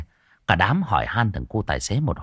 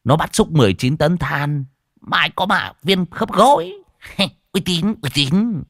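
put-on a lightly raised, pleased old man's brag; boastful old man; 8 semitones higher, 4 dB louder than usual. Old man bragging to his friend, lightly raised boastful voice. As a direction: crow about it, half-laughing.

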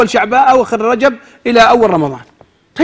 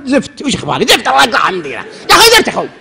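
grumpy stranger; 7 semitones higher than usual. Retold or imitated. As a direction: push the preacher away irritably. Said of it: "قال". (رجل عند الإشارة يصد المطوع بتذمر وصوت مرتفع)

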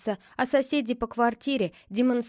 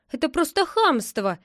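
higher, indignant outburst; flaring up; 6 semitones higher, 4 dB louder than usual. A narrator's reading. Neighbour refused the telephone by a rude boy, flaring up. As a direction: flare up at plain rudeness. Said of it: "вспыхнула соседка".

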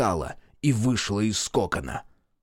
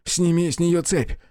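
высокая громкая мольба; умоляет в панике; 8 semitones higher, 4 dB louder than usual. A narrator's reading. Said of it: "взмолился Люк". (Люк умоляет Мерла снять цепь)

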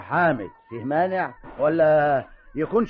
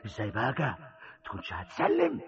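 brooding to himself; 7 semitones lower, 7 dB quieter than usual. Acted. Brooding alone over ruined land.

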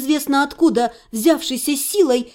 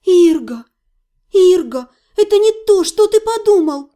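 high, louder desperate calls; urgent pleading; 6 semitones higher, 5 dB louder than usual. A narrator's reading. Heroine begging her fiancé to listen, urgent high pleading. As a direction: plead urgently, pitch climbing.

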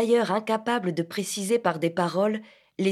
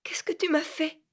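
high tearful whisper; broken whisper through tears; stammered through tears; 9 semitones higher, 3 dB quieter than usual. A narrator's reading. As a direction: whisper it weeping. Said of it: "elle pleurait et balbutiait".

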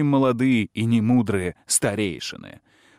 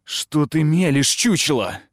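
louder indignant retort; 6 semitones higher, 6 dB louder than usual. A narrator's reading. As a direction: scold him in outrage. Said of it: "возмутилась Лилия".

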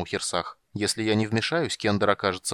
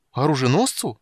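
high, louder stunned echo; 5 semitones higher, 5 dB louder than usual. A narrator's reading. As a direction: repeat it in disbelief. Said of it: "ошарашенно повторил Тасельхов".

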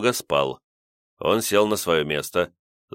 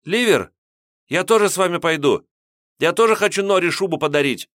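put-on much higher, eager young boy; ringing boy's voice; 11 semitones higher, 4 dB louder than usual. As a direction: pipe up like an eager kid. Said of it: "звонкий голос мальчишки".

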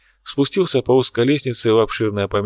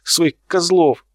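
indignant outburst; 7 semitones higher than usual. Acted. Artist keeps shouting back.

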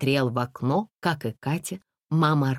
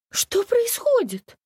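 much higher, piercing shriek of a panicky lady; shrill lady's cry; 3 dB louder, slower than usual. A narrator's reading. Panicky lady cries out in the dark bus. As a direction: screech it like a hysterical lady. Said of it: "закричала дамочка".

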